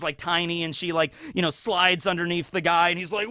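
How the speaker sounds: ordinary talk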